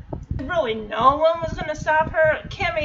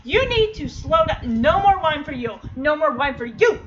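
louder, emphatic telling-off; raised-voice mock exasperation; mock scolding; 4 semitones higher, 6 dB louder than usual. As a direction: scold a tipsy friend, playfully firm. Mock scolding tipsy show characters.

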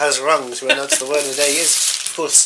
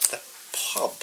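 breathy shocked whisper; whispering in horror that they came from a pub; 11 dB quieter than usual. Acted. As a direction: whisper the words in disbelief.

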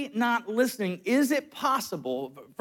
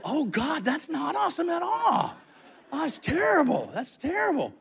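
high, laughing, over-the-top dismay; mock horror; 7 semitones higher than usual. Boyfriend realizing his gaffe, high hammed-up horror. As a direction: ham up the shock with a laugh. Horrified reaction, retold.

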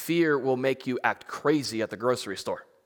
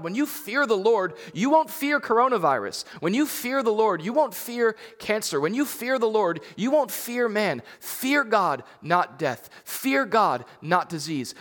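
higher, punchy repeated refrain; rousing preaching; 6 semitones higher than usual. Acted. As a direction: drive each line home to the congregation.

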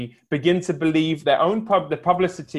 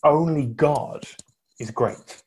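slow, weighty, with Only lifted; slow solemn quote; quoted proclamation; slower than usual. Retold or imitated. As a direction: quote it like a funeral sermon. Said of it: "As Massillon said".

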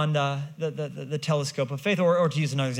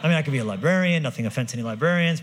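ordinary delivery

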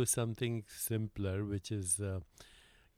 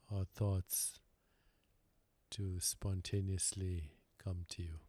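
calm, unhurried meditation guide with long pauses; guided meditation. Real, not acted. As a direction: lead them gently inward, leaving silence between phrases.

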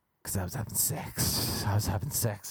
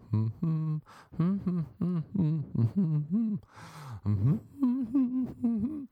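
high little hummed tune; demonstrated humming; 6 semitones higher, much slower than usual. Shown as a demonstration. Showing how he'd hum during sex, high humming. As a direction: hum wordlessly, climbing higher as it goes. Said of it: "I'm gonna try humming".

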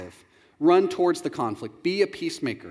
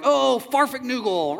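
slow, prim fake curse; 7 semitones higher, slower than usual. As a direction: swallow the swear into nonsense.

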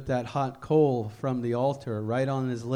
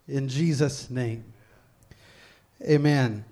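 fervent prayer; 2 semitones higher, 7 dB louder than usual. Real, not acted. Pastor praying aloud for God to search him, fervent prayer. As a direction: plead with God, swelling in force, then settle on the amen.

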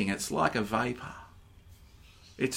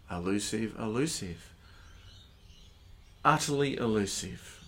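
soft reflective reading; 2 semitones lower, 8 dB quieter than usual. Acted. Rereads verse with new word.

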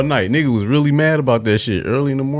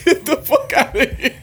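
laughing. Real, not acted. Echoing the mom line laughing.

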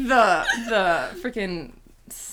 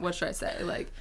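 ordinary talk, nothing marked

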